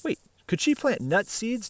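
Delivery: ordinary delivery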